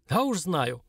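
usual speaking voice

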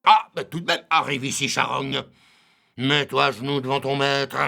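aboyé, aigu et fort; écrase-la de ton autorité; ordre tyrannique. Acted. Ubu ordonne à sa victime. (tyran qui ordonne fort)

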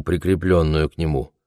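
ordinary delivery, nothing marked

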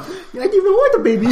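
high-pitched comic complaint; mock distraught parent. Parent disowning the baby, mock distraught. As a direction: pitch it way up, half laughing.

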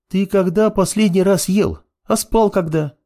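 high-pitched female character, pressing questions; pestering girlfriend. A narrator's reading. Girl to apprentice who forgot food and sleep, pestering. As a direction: question him insistently in a high voice.

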